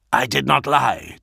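plain reading voice

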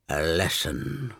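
low, slow dying croak. Acted. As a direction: croak it through blood. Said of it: "Erebus croaked finally".

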